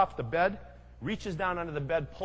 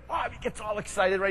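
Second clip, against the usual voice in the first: usual speaking voice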